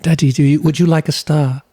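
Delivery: playful, proper toddler impression; imitating young daughter. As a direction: mimic a small child making Daddy an offer. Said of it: "That's usually how the conversation goes".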